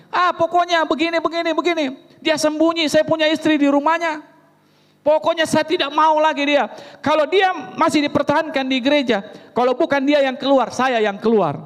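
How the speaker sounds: high, pressing, stubborn demand; husband threatening to leave the church; heated ultimatum. Retold or imitated. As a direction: push the ultimatum hard.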